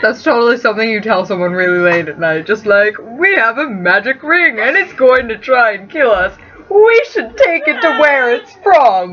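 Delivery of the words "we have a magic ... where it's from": mock dramatic pitch. Mock late night pitch.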